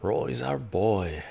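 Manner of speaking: ordinary talk, nothing marked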